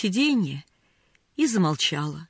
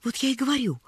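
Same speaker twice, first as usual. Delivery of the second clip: put-on higher, eager child's interjection; agitated; 5 semitones higher, 3 dB quieter, faster than usual. A narrator's reading. Pollyanna picks up her argument, excited. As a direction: jump in keenly. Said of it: "взволнованно подхватила Полианна".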